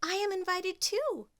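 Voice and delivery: hopeful and bright; hopeful Cinderella. Acted. Cinderella to her stepsisters, high and eager. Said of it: "said Cinderella".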